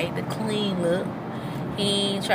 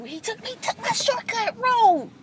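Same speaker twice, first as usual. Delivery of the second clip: "he took my shortcut road" pitched much higher in disbelief; surprised exclaim; 13 semitones higher than usual. Real, not acted. Repeats line, first raised.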